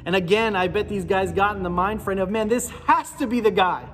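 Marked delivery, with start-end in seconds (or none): 2.3-3.9 a touch higher and louder, eager; eager disciples sure this is the moment; hyped disciples. Retold or imitated. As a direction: voice the disciples' rising hopes. Disciples' imagined hopeful thoughts.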